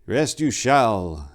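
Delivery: put-on slow, kindly old abbot; warm smile. A narrator's reading. Abbot Gelfus sending weary Tor to rest, warm. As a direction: smile it warmly and slowly. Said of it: "Abbot Gelfus smiled warmly".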